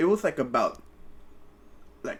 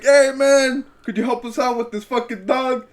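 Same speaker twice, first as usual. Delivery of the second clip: loud high call across the graveyard; retold call for help, pitched high and loud; 10 semitones higher, 7 dB louder than usual. Calling to the gravekeeper.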